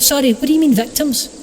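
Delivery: high-pitched, incredulous question; taken aback. Acted. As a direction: query it in mock alarm, voice jumping up. Alex taken aback by victims remark.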